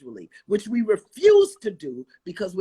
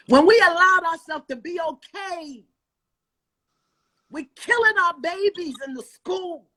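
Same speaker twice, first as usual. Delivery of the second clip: loud, high rallying cry; impassioned preaching; 10 semitones higher, 5 dB louder than usual. Real, not acted. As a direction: rouse the crowd to act. Impassioned preaching about school shootings.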